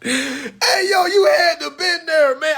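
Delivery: loud laughter while speaking; laughing. Real, not acted. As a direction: laugh through it, calling out. Still laughing.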